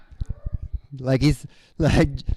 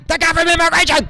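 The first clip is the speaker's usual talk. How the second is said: high, louder alarmed repeat; mock alarmed staff; 12 semitones higher, 6 dB louder than usual. Staff warning coworkers, higher and louder. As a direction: warn the others, pitched up and loud. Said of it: "And they're like".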